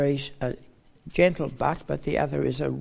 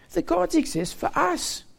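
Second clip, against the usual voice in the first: much higher, scoffing voice; high, scornful dismissal; mocking pagan; 12 semitones higher than usual. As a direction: scoff at Paul as a Corinthian would. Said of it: "they'd say".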